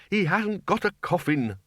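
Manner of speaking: slow, high wail. A narrator's reading. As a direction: wail it out, near the end of his tether. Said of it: "wailed the bursar".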